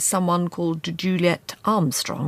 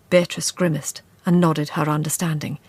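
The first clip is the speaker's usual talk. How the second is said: plain reading voice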